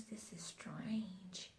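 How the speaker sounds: slow hushed whisper; slow whispered wonder. Real, not acted. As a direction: breathe it out in awe. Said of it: "Oh my goodness".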